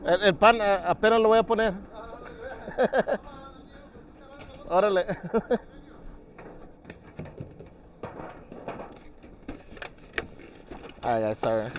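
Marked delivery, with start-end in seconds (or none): 1.8-4.6 laughing. Real, not acted. Laughing while cooking. 5.1-11.0 laughing. Real, not acted. Laughing while cooking.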